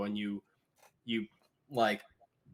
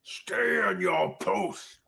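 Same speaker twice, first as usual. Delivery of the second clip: slow, higher, louder command to a guard; barked order; 10 semitones higher, 4 dB louder, much slower than usual. Acted. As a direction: bark it like a warlord setting a sentry. Orc leader commands guard.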